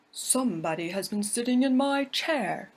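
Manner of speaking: a big bear's gruff growl. Acted. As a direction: growl it like a big father bear. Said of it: "growled in his great gruff voice".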